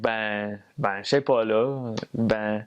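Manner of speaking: casual Quebec French accent; Quebecois French. Shown as a demonstration. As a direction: demonstrate everyday Quebecois filler words. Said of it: "They'll be like".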